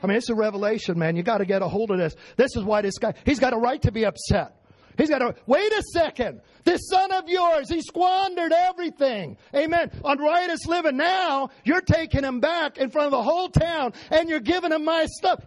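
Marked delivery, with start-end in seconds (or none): 5.0-15.4 put-on indignant older son. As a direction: protest like a wronged older brother. Preacher voices the angry older son.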